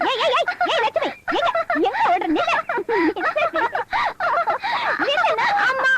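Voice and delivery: high urgent calls after her; calling out. Acted. Woman calling after Lakshmi as she runs, high urgent shouts.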